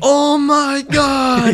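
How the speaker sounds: high drawn-out cartoon shout; anime character shout. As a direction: stretch it out high like an anime hero. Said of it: "And he says".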